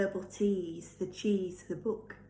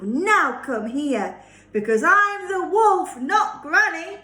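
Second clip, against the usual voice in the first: louder, slow, menacing wolf; big wolf reveal; 7 semitones higher, 9 dB louder, slower than usual. Acted. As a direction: boom it out slowly as the wolf.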